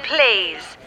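normal talking voice

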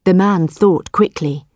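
plain reading voice

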